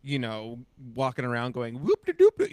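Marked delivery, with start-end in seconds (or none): 1.8-2.5 high, loud goofy whoop; mock silly clowning. Castmate saying she never clowned around, mock silly whooping. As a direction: burst out with a zany whoop.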